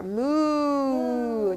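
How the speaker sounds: slow playful moo; cow moo. Shown as a demonstration. Teacher mooing like a cow for a child in cat-cow. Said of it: "The louder we yell".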